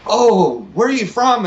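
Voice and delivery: mock surprise, drawn-out Oh; curious waiter. Guest imitating Nashville diner waiter meeting him, high curious voice. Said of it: "the waiter coming up and saying".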